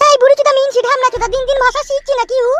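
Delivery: high, foul-mouthed wife cursing; a wife hurling abuse at her husband, high voice; angry wife's voice. Acted. Wife curses husband back. (উঁচু গলায় গালাগালি করা বউ)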